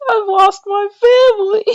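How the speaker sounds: put-on high-pitched wailing lost kid; hammed-up tearful cry for help; mock bawling. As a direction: wail like a bawling child. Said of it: "And then I start bawling".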